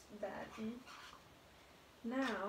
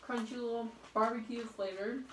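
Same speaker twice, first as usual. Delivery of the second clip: talking between bites; chewing while talking; 4 dB louder than usual. Real, not acted. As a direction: mouth partly full. Seen 2: Chip put in mouth at -2; lips pressed, chewing while talking.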